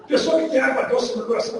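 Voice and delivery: ordinary talk, nothing marked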